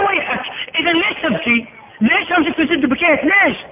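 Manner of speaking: high, pressing king's challenge; a tyrant baffled by his prisoner's tears; demanding king. Acted.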